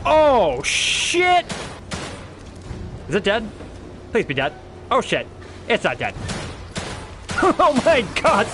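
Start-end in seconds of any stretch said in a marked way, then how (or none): 0.1-1.5 startled yell. Real, not acted. Enemy appears suddenly. 7.4-8.5 excited shout. Real, not acted. Wild moment in game.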